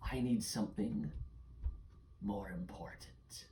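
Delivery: haughty creature, whispery in places; shadow creature. Acted. Shadow creature refusing a child's shield, haughty then hushed. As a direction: scorn the offer, then press with slow, hushed menace.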